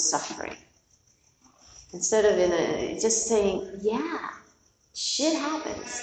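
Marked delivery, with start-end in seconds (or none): 3.8-6.0 higher, slow and drawn out, a wry mock-wise pronouncement; "shit happens" raised and slowed, as if quoting the Buddha; mock Buddha quote. Shown as a demonstration. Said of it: "That's my quote of the Buddha".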